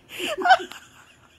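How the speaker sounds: sudden high loud laughing fit; shrieking laugh; loud burst of laughter. Real, not acted. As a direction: explode into laughter at the cat. Owner bursting into laughter at cat.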